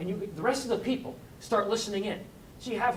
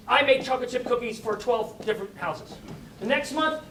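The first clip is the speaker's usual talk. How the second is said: ordinary talk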